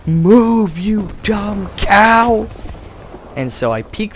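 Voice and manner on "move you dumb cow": loud, high yell, dragged out slowly; screaming at the tank. Retold or imitated. Gamer screaming at his own sluggish tank. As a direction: scream at the slow tank to move. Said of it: "and I'm screaming".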